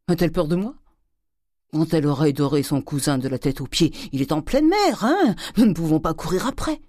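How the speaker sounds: hector the wife; ranting old miser. Acted. Grandet rants at his wife. (le vieil avare qui s'emporte)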